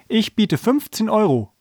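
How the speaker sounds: high-pitched call from the crowd; called-out bid. A narrator's reading. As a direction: throw your bid in from the front. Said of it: "rief ein dicker Mann".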